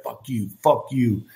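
imitating a taunting schoolkid, low and slow; mock the bully's jeer, drawn out; taunting kid. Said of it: "he used to be like".

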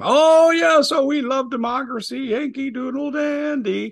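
very high, loud sing-song falsetto; high sing-song mockery; mock patriotic politician. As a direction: ham up a fake patriot, slowing at the end. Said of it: "will sing a good game".

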